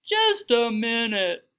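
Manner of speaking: loud, slow, drawn-out call; big unhurried intercom voice; deep intercom voice. A narrator's reading. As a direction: boom it slowly, stretching each word. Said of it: "a deep voice on the intercom".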